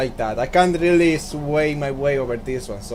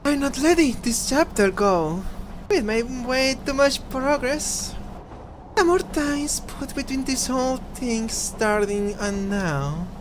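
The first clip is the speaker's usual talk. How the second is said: raised character voice; 7 semitones higher than usual. Acted. Voicing a game character.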